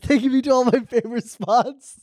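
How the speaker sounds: high, gleeful joyride voice; excited. Pretend. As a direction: squeal with glee, slow. Joyride in hacked car.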